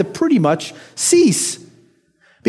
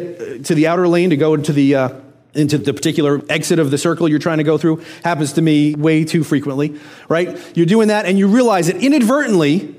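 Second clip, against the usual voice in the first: ordinary talk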